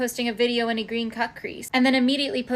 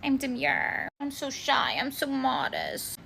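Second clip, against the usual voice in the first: slow, soft fake-shy voice; mock demure; 4 dB quieter, slower than usual. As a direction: play at being shy and modest, softly. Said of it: "tell me you're demure by being like".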